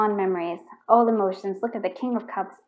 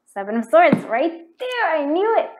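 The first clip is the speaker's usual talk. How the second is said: high, loud burst of real excitement; excited; 9 semitones higher, 8 dB louder than usual. Real, not acted. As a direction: cry out as the hunch is proved right. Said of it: "Sorry, I get so excited".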